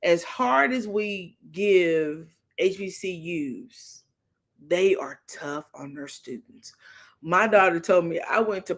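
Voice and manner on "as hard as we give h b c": ordinary talk, nothing marked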